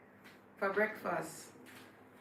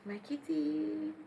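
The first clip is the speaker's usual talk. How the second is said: slow, higher, tender cat talk; cooing to the cat; 5 semitones higher, slower than usual. Real, not acted. Talking to her cat Golden, a slow tender coo. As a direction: coo it to your kitty.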